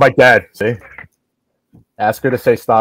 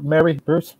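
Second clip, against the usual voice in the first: ordinary delivery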